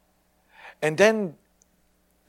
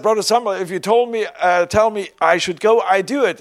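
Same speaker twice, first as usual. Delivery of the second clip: loud, higher indignant comeback; protesting; 5 semitones higher, 8 dB louder than usual. Retold or imitated. Preacher answering his teasing friend, loud protest. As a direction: push back hard but good-naturedly. Defending himself against teasing.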